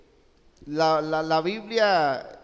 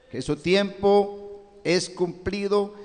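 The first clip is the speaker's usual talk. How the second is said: ordinary talk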